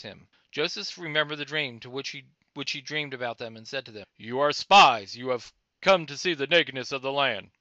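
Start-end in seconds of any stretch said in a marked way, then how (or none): 4.2-7.5 loud, hard accusing voice; stern accusation. A narrator's reading. Joseph accusing his brothers of spying, loud and stern. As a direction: hurl the charge at them.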